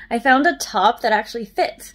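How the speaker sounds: ordinary talk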